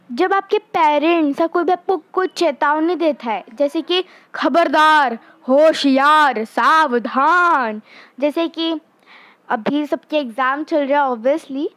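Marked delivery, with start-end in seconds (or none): 4.4-7.8 loud, drawn-out warning cry; warning call. Shown as a demonstration. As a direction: call out each warning like an alarm. Warning words acted loud. (ज़ोर से चेतावनी देते हुए)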